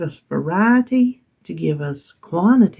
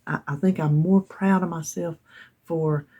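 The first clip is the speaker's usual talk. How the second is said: ordinary talk, nothing marked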